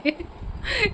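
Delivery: short, soft, high giggle; soft high laugh. Real, not acted. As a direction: laugh it off lightly. Laughs about noisy birds.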